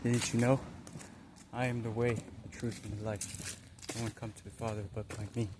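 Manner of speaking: quiet, measured divine pronouncement; voice of the Spirit. Retold or imitated. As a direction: let it come out softly, like God speaking through you. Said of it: "it came out of me".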